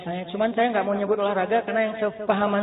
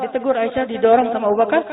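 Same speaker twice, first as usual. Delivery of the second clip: normal talking voice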